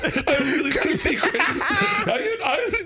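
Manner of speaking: high-pitched, laughing confession; giggling at your own indiscretion; cracking up. Real, not acted. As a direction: laugh helplessly through the words. Cracking up confessing he leaks secrets.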